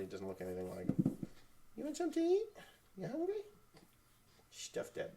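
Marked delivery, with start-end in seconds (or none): 1.8-3.3 very high cooing question. Real, not acted. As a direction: float it up high to the infant. Asking baby if hungry.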